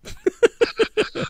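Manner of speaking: sudden high giggle; high laugh. Real, not acted. Laughing at own confession.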